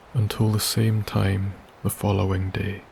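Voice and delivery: ordinary delivery, nothing marked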